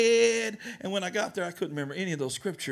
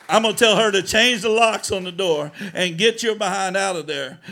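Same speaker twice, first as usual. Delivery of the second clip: much louder, driving preacher's warning; forceful preaching; 2 semitones higher, 8 dB louder than usual. Acted. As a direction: hammer the advice home at full force. Pastor lays down hard advice.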